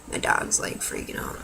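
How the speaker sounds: quick whispered aside about the dog. Real, not acted. Whispering to the camera about her barking dog. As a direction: drop to a whisper for a side remark.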